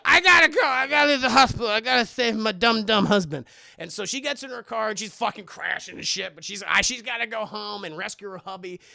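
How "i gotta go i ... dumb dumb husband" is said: loud mock panic; imitated frantic mother. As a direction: shout it as a frantic wife.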